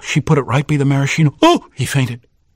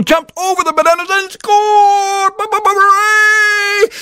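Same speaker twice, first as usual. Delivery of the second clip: excited cheer. A narrator's reading. Narrator shouting the winning score, thrilled. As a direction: celebrate the last-second goal.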